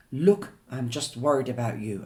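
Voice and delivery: ordinary delivery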